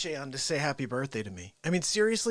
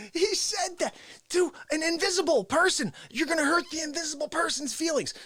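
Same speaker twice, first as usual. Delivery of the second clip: high, incredulous repeat; amused disbelief; 11 semitones higher than usual. Real, not acted. Storyteller repeating Fauci's line to viewers, high-pitched incredulity. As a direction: pitch it up, marvelling at the absurdity.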